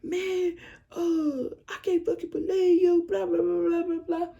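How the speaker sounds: high whiny mock of him yelling. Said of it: "He was yelling at me".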